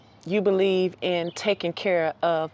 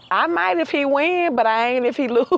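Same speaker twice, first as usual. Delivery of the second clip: a sassy female bird, much higher and louder, laughing at the end; sassy hen; 6 semitones higher, 4 dB louder than usual. Pretend. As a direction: toss it off like a choosy hen. Hen answers her friend.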